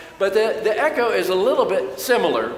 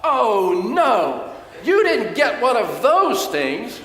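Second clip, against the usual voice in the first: higher, louder mock outburst from the son; retold yell; 7 semitones higher, 4 dB louder, slower than usual. Preacher imitating his suspicious son yelling about the gift, loud mock alarm. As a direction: imitate a cynical son crying out in alarm, half laughing. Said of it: "he literally yelled out".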